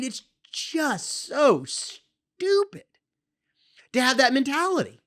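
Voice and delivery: real worked-up disbelief, stretching words for emphasis. Real, not acted. Host fed up with selfish parents' mentality, worked up. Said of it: "I know I'm getting worked up".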